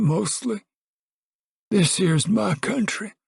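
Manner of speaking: put-on high old man's voice, stubborn; stubborn Grandpa. Grandpa insists to Al and Pa he's fine, high and stubborn. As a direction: crank it up high like a cranky grandpa.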